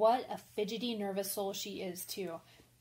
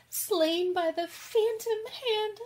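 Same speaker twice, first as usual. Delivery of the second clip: higher and slow, breaking into tears; crying; 10 semitones higher, 5 dB louder, slower than usual. Acted. Jo moved to tears by her own story's ending, crying. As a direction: weep through the tragic last line. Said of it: "You're crying".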